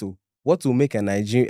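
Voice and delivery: ordinary talk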